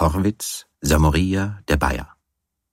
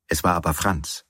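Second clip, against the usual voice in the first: ordinary delivery, nothing marked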